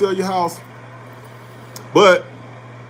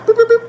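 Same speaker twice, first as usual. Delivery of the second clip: shrill-pitched machine beeps, loud and high; high mock beeping; 8 dB louder, faster than usual. Imitating the bank site beeping at you while logging in. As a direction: beep like a bank login screen, high and loud.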